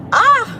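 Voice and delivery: sudden high yelp in traffic; startled yelp. Real, not acted. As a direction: jump and cry out. Startled on the road.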